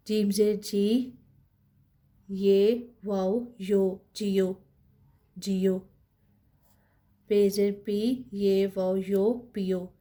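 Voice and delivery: each letter and vowel mark named slowly, then the syllable; slow spelling drill. Shown as a demonstration. Teacher spells out syllables slowly for students. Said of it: "teacher spelling out letters".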